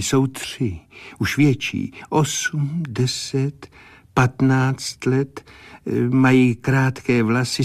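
clairvoyant's vision. Acted. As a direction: pick out each detail as it appears to you. Clairvoyant describing the children.

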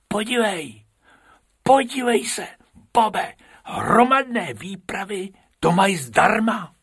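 high, excited rabbit voice. Acted. Bobek excitedly showing Bob the free entry trick. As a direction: eagerly point it out.

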